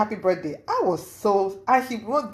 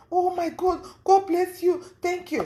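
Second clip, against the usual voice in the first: high-pitched imitation of grateful delight; acted-out overjoyed thanking; gushing thanks; 10 semitones higher than usual. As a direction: gush with gratitude, pitched way up. Acting out her delighted thanks.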